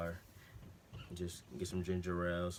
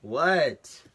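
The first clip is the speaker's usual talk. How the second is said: loud annoyed call back, higher; real shout; 9 semitones higher, 16 dB louder than usual. Real, not acted. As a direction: yell back to the next room. Shouting back to family off-camera.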